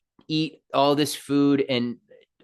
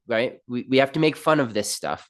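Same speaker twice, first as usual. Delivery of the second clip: ordinary delivery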